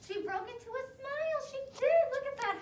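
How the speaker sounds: delighted. Real, not acted. Teacher celebrating baby's smile with listening kids, high and thrilled. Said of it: "happy ending of story".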